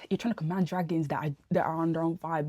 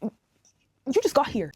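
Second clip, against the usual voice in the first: mock retort; 7 semitones higher, 6 dB louder, much slower than usual. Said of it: "I'm like".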